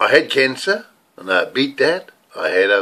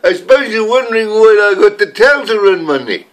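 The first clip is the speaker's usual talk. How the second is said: comic character voice; 9 semitones higher, 4 dB louder than usual. Acted. Comedy character greeting, high voice.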